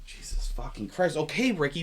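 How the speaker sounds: ordinary delivery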